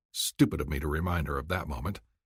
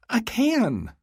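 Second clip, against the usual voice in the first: much higher, squealing with joy; excited squeal; 4 dB louder than usual. Acted. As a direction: squeal it out, thrilled. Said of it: "A sound like a squeal erupts from her".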